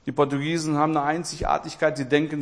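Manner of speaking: ordinary delivery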